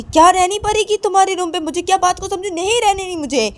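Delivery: wife scoffing at her husband's demand; indignant outburst. Acted. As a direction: throw his words back at him. Wife snaps back at him.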